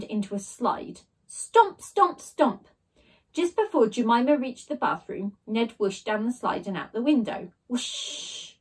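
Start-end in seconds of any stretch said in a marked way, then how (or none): 1.3-2.7 high, loud stomps; stomp sound. A narrator's reading. Narrator voicing Jemima stomping closer, loud. 7.7-8.5 whoosh. A narrator's reading. Ned down the slide.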